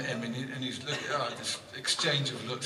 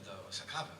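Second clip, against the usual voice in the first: se acaba said softly and lower, shrugging; mild, innocent reply; 5 semitones lower, 10 dB quieter, much faster than usual. Retold or imitated. Vladimir calmly defending his cue, softly. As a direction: quietly insist se acaba. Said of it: "He said".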